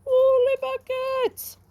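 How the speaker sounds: high, slow astonished cry; amazed exclamation. Real, not acted. Amazed at crocodile page.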